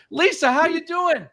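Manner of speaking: the voice breaks upward on a bright hello; cracking greeting. Real, not acted. Host with a sore throat greets the guest, voice cracking. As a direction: push through a cracking voice to greet her. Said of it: "My voice is cracking".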